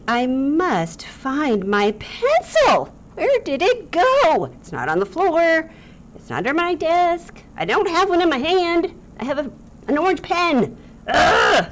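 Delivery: high, loud hammed-up frantic search; overacted panic. Shown as a demonstration. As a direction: ham up a lost-pencil meltdown. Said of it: "I might have overacted it".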